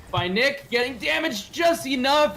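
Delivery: high, loud and drawn out; excited shout. Real, not acted. Caster watching a near kill, slow excited shout. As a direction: stretch the words as the tension peaks. Caster calling a close fight.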